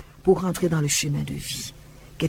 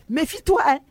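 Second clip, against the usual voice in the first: high warning; 11 semitones higher than usual. Retold or imitated. Woman warning the Virgin.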